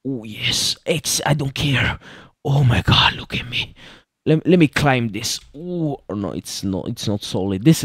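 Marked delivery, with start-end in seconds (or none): 3.1-3.7 awed unvoiced whisper. Real, not acted. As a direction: whisper it in awe. Admiring his snake.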